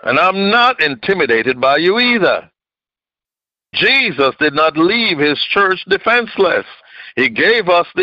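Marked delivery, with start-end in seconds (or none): none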